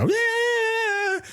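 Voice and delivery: long high falsetto shriek; mock scream. Women running around the kitchen, mock screaming. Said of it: "women are just running around screaming".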